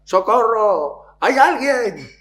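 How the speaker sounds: high, loud cry for help; shouting for help. Retold or imitated. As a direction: yell out desperately from the cliff. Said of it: "Y empieza a gritar". (grito desesperado pidiendo socorro)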